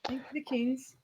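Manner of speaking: whispered aside; whispering. Acted. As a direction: whisper it under your breath. Unvoiced whisper.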